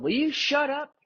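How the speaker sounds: high mock yell; imitating angry caller. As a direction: yell at the cat in a put-on voice. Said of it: "that's what he said".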